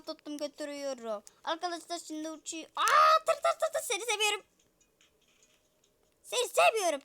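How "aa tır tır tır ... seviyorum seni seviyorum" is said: gushing. Pretend. Gushing love to truck.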